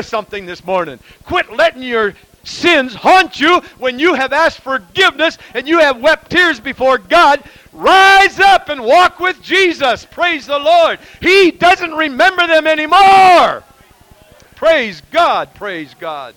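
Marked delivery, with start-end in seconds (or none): 1.2-13.6 high, loud fiery preaching, building to a shout; preaching at full force. Acted. 14.6-15.5 "Praise God" called out high and loud. Real, not acted. Loud praise after shouting peak. 15.5-16.3 "Praise God" murmured low and soft; quiet echo. Real, not acted. Quiet echo after loud praise.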